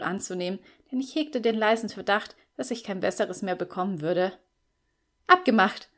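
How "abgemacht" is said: louder, firm and decided. A narrator's reading. Agreeing to the flatmates' deal, firmly. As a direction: seal the deal with conviction. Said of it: "sagte ich daher mit fester Stimme".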